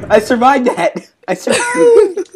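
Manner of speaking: high, giddy words through laughter; laughing relief. Real, not acted. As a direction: exclaim it while cracking up. Relieved after surviving the blast.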